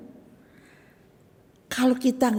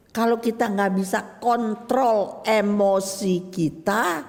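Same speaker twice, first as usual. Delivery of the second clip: usual speaking voice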